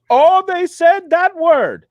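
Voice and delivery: put-on high, slow Indian accent. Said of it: "That, that was close to an Indian accent".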